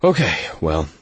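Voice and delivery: mild resigned sigh. A narrator's reading. Go-between gives up on the silent pair, mildly sighed. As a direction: let the words out on a slight sigh. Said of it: "he sighed".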